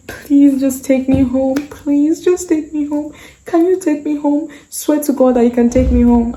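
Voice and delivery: imitating a tearful plea. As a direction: beg the driver in a mock tearful voice. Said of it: "I was crying".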